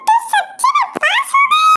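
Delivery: very high, tearful shout; shrieking in disbelief; disbelieving cry. Acted. Sister asks again, very high and tearful.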